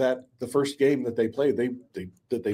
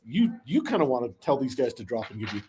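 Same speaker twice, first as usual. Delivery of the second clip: ordinary talk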